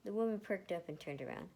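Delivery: plain reading voice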